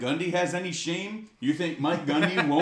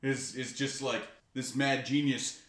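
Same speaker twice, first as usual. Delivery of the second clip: usual speaking voice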